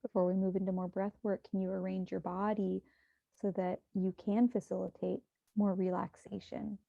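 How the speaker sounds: hushed, even meditation guidance; guided meditation. Acted. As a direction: guide listeners gently into breath and body.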